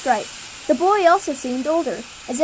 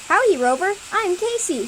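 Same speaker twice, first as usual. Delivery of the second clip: mild shout; 6 semitones higher than usual. Acted. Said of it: "shouts the redhead".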